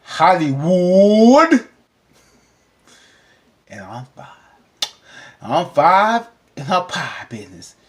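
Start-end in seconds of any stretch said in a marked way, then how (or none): 0.0-1.7 announcer stretch. Pretend. Announcing next topic.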